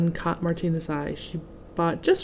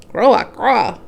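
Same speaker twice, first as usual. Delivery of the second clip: loud croaking frog; the frog's noisy reply, much louder; 3 semitones higher, 8 dB louder than usual. Acted.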